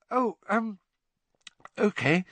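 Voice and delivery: high, hesitant acceptance; flustered. A narrator's reading. Girl accepting the headmaster's invitation, flustered. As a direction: falter meekly into agreeing.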